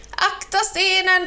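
high, slow urgent call; shouted warning. A narrator's reading. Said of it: "ropade Kråkan".

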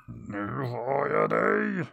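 slow, drawn-out gloating monster voice; slow triumphant monster claim, no real shout; menacing sun monster. Acted. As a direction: savour the catch like a hungry sun. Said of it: "skrek på mannen".

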